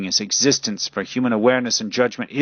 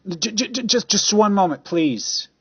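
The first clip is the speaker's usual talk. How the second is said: frantic stammering plea; 12 semitones higher, 4 dB louder than usual. Acted. Caller desperately stops hang-up.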